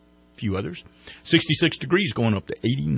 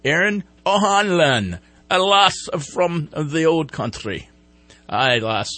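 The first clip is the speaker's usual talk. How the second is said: put-on Irish accent; 6 semitones higher, 5 dB louder, slower than usual. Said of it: "with my accent".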